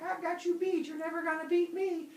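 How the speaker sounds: tiny, high-pitched fly voice; taunting little fly. Acted. Zeus as a fly boasting to her in the game, high taunting voice. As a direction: squeak out a cocky taunt.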